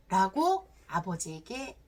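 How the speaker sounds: ordinary delivery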